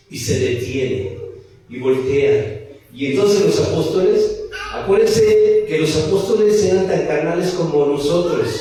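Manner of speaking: usual speaking voice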